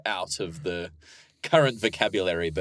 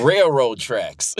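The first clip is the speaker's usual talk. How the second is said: slow, louder Southern drawl on "railroad tracks"; southern accent; 5 dB louder, much slower than usual. Shown as a demonstration. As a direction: lean into a drawn-out Southern American accent. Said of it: "It's like a southern accent".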